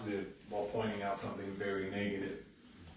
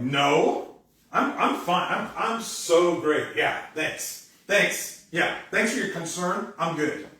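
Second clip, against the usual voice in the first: loud, higher-pitched insistent fake okayness; passive-aggressive; 5 semitones higher, 7 dB louder, slower than usual. Person with a grievance denying anything is wrong, passive-aggressive. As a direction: overdo the reassurance, passive-aggressively. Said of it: "with a passive aggressive".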